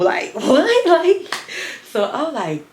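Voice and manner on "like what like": high, loud shocked squeal; mock shocked squeal. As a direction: squeal it out in disbelief. Shocked reaction quote.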